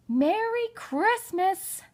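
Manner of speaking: high, slow, drawn-out merry call; cheery greeting. Acted. Grandmother arriving with packages, calling out merrily. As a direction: sing out the greeting at the door.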